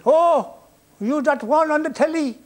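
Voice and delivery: high, loud Irish brogue, amazed; Irish accent. Retold or imitated. Embassy man on the phone to Spike, in a high, loud Irish accent.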